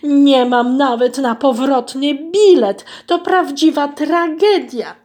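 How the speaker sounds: high, loud, lamenting wail. Acted. Goose wails to herself about her lost purse, lamenting. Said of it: "biadolenia i użalania się".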